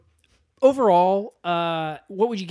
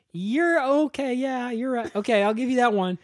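reluctant concession; 7 semitones higher than usual. Acted. Director conceding Kyle's point after the rant, placating.

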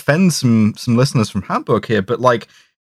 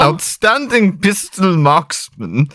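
put-on hammy German accent, laughing; 4 semitones higher, slower than usual. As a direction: quote the hitman in a bad German accent. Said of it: "me doing a German accent".

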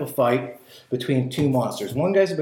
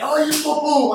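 punch noises; 11 semitones higher than usual. Retold or imitated. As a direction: ham up the fight noises.

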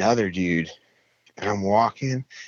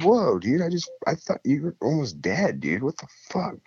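higher, taken-aback retort; startled; 7 semitones higher than usual. Retold or imitated. Rescuer startled by the junkie's outburst, higher. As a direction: jump back in surprise with a raised voice.

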